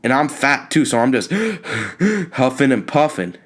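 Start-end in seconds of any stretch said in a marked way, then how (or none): 1.4-2.3 high gasp for air. Retold or imitated. Fat kid huffing after running, gasping. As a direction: gasp like you've just sprinted home.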